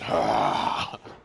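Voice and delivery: low, loud drawn-out growling noise; imitated roar. Friend imagining the bear shut in cockpit, imitated roar.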